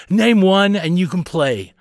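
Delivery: high, loud, slow dare; taunting older brother. A narrator's reading. Bobby setting Carley a test, taunting.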